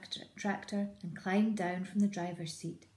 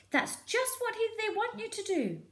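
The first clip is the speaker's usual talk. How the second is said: much higher mother fox voice; Missus Fox. Acted. Missus Fox warning her children, high voice. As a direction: explain urgently. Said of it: "said Missus Fox".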